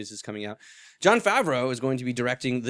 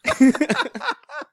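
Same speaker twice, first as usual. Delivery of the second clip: squeaky high-pitched giggle; 5 dB louder than usual. Real, not acted. High laugh.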